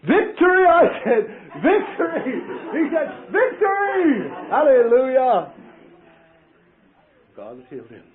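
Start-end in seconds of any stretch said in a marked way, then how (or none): 0.0-0.7 high, loud cry of victory; triumphant shout. Retold or imitated. Exultant shout after healing. 1.6-2.4 high, loud cry of victory; triumphant shout. Retold or imitated. Exultant shout after healing. 3.3-5.5 high, loud cry of victory; triumphant shout. Retold or imitated. Patient shouts victory too.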